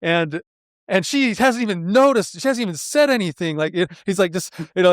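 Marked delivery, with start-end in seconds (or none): 0.0-3.6 imitated exasperated complaint, pitched high; worked-up mock grievance, higher voice; frustrated. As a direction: act out an aggrieved husband venting. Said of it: "I'm so frustrated right now".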